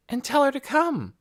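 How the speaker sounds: put-on high, light girl's voice; a curious young girl asking; young girl Nikki. A narrator's reading. Nikki's lines in the story.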